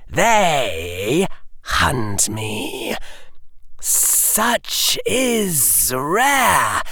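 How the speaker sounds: high, slow menacing villain voice; cold rasping evil emperor. Acted. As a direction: threaten like an ancient tyrant. Dark Lord musing coldly.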